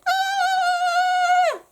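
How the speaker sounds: high, loud panicked shriek; high terrified squeal; goblin scream. Acted. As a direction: shriek at every word. Prince keeps screaming.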